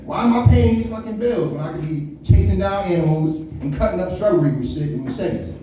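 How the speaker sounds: usual speaking voice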